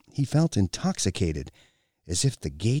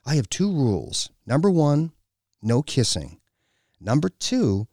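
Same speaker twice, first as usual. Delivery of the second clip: higher-pitched, bossy woman laying down rules; domineering woman; 6 semitones higher, 3 dB louder than usual. A narrator's reading. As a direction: size him up, then dictate terms. Woman appraises him, lays down rules.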